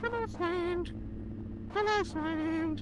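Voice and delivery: very high, sing-song hello; high cutesy greeting. Pretend. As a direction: greet them in a cute squeaky voice.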